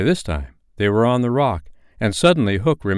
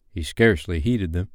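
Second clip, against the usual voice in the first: ordinary delivery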